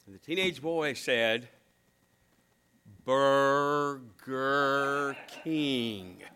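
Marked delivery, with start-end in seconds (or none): 3.0-6.2 drawn out syllable by syllable; very slowly. Retold or imitated. Teenage boy answering the dad, very slow. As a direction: stretch "Burger King" out as slowly as you can. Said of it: "say it very slowly".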